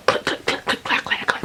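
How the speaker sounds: whispered rapid wheelchair clatter; clicking and clacking. Retold or imitated. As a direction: imitate a wheelchair knocking along in whispers. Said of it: "The clicking and clacking".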